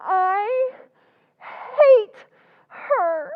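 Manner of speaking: loud, crying, bitter; tearful hatred. Acted. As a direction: sob out the hate, pressing on hate. Sister sobbing out her hatred.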